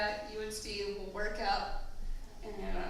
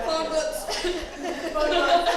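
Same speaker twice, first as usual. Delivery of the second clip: loud laughing shout; 6 semitones higher, 10 dB louder than usual. Real, not acted. Bridesmaid shouting an inside joke to the bride, loud and laughing. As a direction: shout it out high, much louder.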